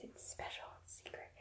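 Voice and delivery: secretive whispered aside. Real, not acted. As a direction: hush it like a secret. Hushed aside about secret food.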